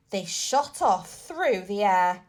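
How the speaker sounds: ordinary delivery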